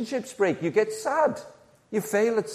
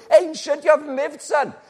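put-on scoffing old-timer; 10 semitones higher than usual. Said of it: "somebody's seventy and they're going".

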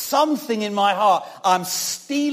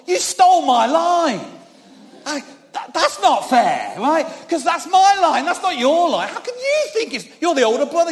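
put-on indignant kid; 8 semitones higher, 4 dB louder than usual. Indignant boy to older brother.

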